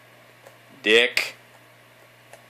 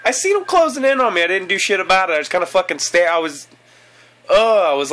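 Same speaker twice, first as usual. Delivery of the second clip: high, loud frustrated outburst; real worked-up frustration; exasperated rant; 10 semitones higher, 9 dB louder than usual. Real, not acted. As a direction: rant in a high voice at your own blunder. Frustrated after dying in game.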